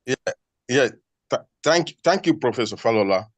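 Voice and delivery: normal talking voice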